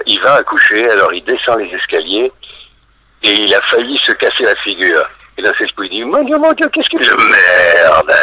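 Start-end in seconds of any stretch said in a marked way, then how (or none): 6.1-8.1 hammed-up falsetto panic, ending in a long drawn-out loud cry; cri paniqué imité. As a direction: squeal very high, then stretch the last word loudly. Acts out pregnant hero's panicked gag. (panique surjouée, très aiguë puis traînante)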